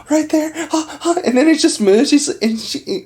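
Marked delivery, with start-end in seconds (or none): 0.0-0.7 high, gasping "Right there."; breathy excited gasps. Retold or imitated. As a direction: gasp the words, thrilled. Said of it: "he's like".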